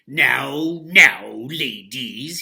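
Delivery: loud, slow stern scolding; a mean boss reining them in; frowning boss. Acted. Mean laundry owner scolding the washerwomen, loud and slow. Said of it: "he said with a frown".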